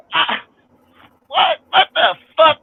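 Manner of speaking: can't believe what he just heard, voice shooting up; incredulous outburst. Real, not acted. Vlogger reacting to his woman believing the podcast, high incredulous outburst. Hearing her agree with the show.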